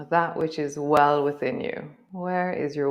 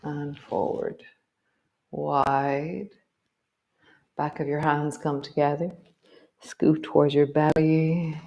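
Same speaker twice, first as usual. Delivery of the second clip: guided movement cue. Acted. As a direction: guide the body gently through each move. Leads a guided movement practice.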